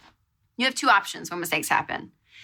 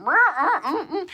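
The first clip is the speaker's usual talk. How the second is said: driving noises; 9 semitones higher than usual. Retold or imitated. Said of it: "I'm just like".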